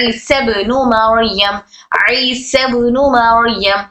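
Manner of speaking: slow, chanted recitation of ईसा ibn Maryam; Quranic recitation. Shown as a demonstration. Teacher reciting the example verse to students, slow and intoned. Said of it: "जैसे यहाँ पर".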